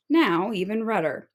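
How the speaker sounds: usual speaking voice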